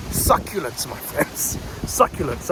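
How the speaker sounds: real voice loss on a drawn-out word; losing voice. Real, not acted. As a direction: voice giving out, half cracking. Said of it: "I'm losing my voice".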